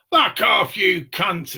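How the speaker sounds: retold abusive shout. Car owner swearing at the repo men, loud shout. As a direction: bark it back like a cornered car owner. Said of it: "you don't speak like that".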